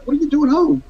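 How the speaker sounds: high, surprised wife's question. Retold or imitated. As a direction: ask it like a startled wife. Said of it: "My wife's like".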